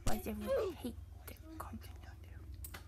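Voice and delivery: secret whisper; conspiratorial whispered joke; whispered aside. Real, not acted. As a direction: lean in and whisper it. Whispered aside about the content.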